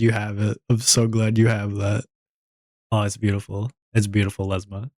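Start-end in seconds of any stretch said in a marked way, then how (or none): none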